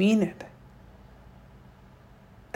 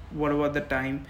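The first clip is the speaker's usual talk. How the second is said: ordinary talk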